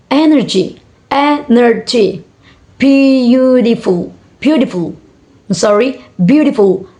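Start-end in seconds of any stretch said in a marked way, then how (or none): 1.1-2.2 energy slowly split into e-ner-gy; syllable by syllable. Shown as a demonstration. Teacher showing three syllable word, syllable by syllable. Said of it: "three syllable words". 2.8-6.0 syllable by syllable. Shown as a demonstration. Teacher showing three syllable word, syllable by syllable. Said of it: "three syllable words".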